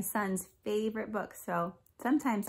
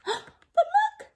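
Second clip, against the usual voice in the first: high, loud storyteller surprise; gasp, then point out the sudden turn; hammed-up amazement for children; excited gasp; 7 dB louder than usual. Storyteller gasps at the turn of events.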